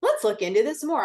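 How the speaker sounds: excited doctor. Retold or imitated. Quoting the enthusiastic doctor.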